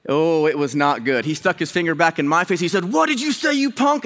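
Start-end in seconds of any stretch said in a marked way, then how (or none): 2.9-4.0 high, outraged mock challenge; yelling neighbor. Neighbor confronting the younger man, retold yelling. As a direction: throw it back as an affronted old man. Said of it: "yelling at me".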